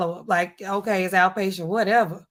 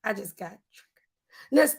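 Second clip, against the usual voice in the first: ordinary talk, nothing marked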